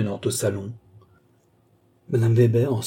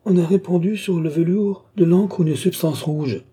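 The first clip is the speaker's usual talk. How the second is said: voix bien plus aiguë et plus forte, stupéfaite; stupeur; 7 semitones higher, 4 dB louder than usual. A narrator's reading. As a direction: laisse éclater la stupeur. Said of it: "d'une voix qui trahissait la stupeur". (avec une stupeur manifeste)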